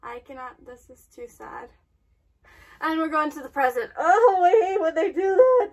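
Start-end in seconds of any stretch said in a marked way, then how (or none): 4.0-5.7 loud, high exasperated wail; upset outcry. Real, not acted. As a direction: cry out in protest at the screen. Upset reaction to the story.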